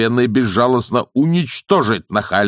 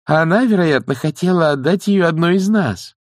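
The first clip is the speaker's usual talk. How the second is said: high, insistent nanny voice; persuasive nanny; 10 semitones higher, 4 dB louder than usual. Acted. Nanny claims the wand for the elders, persuading. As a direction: reason with her in a raised pitch.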